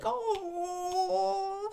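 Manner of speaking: high, drawn-out sympathetic coo; mock weepy "aww"; crying. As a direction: imitate tearful onlookers melting. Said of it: "they were crying".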